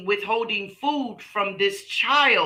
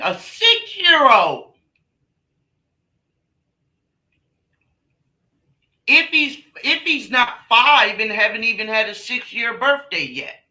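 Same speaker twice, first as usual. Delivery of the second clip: loud, high, outraged disbelief; 5 semitones higher, 7 dB louder than usual. Real, not acted. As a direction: shout it in disbelief. Outraged at child diagnosis.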